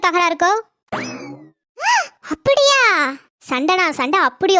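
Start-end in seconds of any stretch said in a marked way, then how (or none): 1.8-2.8 high gasping slow shock; gasp, then ask slowly in disbelief. Acted. Neighbour gasps at gossip of a big fight, slow and shocked.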